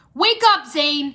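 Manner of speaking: loud, high urgent shout. A narrator's reading. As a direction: yell it to wake him up. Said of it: "he shouted".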